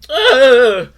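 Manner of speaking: loud, high exasperated groan; disgusted groan. Real, not acted. Reviewer lost for words about the snakes, loud disgusted groan.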